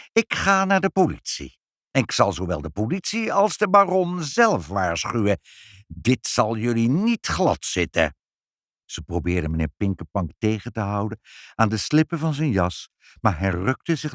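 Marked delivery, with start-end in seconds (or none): 0.1-8.2 high threat, slowing at the end; nasty voice. A narrator's reading. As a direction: menace them in a nasty voice. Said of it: "met zijn akelige stem".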